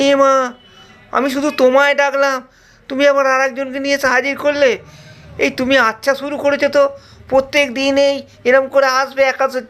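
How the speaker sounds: high, fast whiny complaint; a greedy disciple sulking over lost food; whining disciple. Acted. Disciple whining at Rama for eating everything, high and fast.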